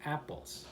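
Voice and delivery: soft "apples" with dropping pitch; falling intonation. Shown as a demonstration. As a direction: drop quietly through "apples". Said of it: "It goes down".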